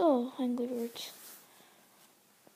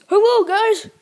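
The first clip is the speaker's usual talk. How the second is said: loud, raised-pitch hail; calling out; 5 semitones higher, 13 dB louder than usual. Pretend. Mario arrives to warn the Sonic characters, calling out. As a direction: call to a group across the way.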